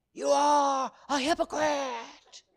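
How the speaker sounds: high, loud, drawn-out accusation; Doctor George Francis. Retold or imitated. Evangelist's remembered voice accusing him, thundering shout. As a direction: thunder the charge slowly from memory. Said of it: "heard Doctor George Francis's voice going".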